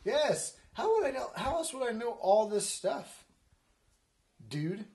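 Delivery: high, loud defensive protest; insist, affronted at being doubted; indignant retort. Real, not acted.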